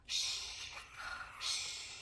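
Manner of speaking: whispered shh; shushing. Pretend. Teacher shushing the class while the squirrel sleeps. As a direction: hush them as he sleeps.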